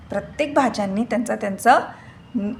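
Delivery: usual speaking voice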